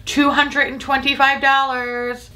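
announcing the price in slow mock outrage; mock scream of price. Real, not acted. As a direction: call out the price slowly and high. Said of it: "scream it a little bit louder".

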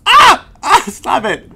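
high, sudden yelps; ticklish yelping. Real, not acted. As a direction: yelp as if being tickled. Cat's fur tickles him.

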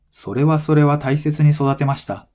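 normal talking voice

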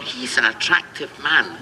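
slow, hammed-up admiration; lightly voiced admiring ladies. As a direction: lightly act out an admirer, slowly. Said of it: "ladies are looking at me going".

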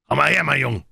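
a high, loud call across the yard; pirate captain calling a nervous envoy closer, loud and high. A narrator's reading. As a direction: call the messenger over, cheerily. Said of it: "rief Silver".

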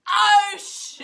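loud, high startled exclamation; shocked shout. Real, not acted. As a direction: cry out, caught red-handed. Caught out on prank call.

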